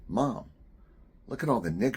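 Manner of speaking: put-on excited child calling to mom, high and loud; high, loud kid's voice. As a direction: pipe up loudly like a small boy.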